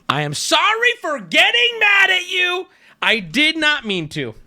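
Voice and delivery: loud, very high-pitched reading; high blare easing down at the end; mock yelled apology. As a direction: shout the apology in a hammed-up voice. Reading his all-caps style apology.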